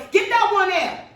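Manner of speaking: sudden high, loud yell; a policeman yelling an order across the street; retold yell. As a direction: bark it out like an officer spotting her.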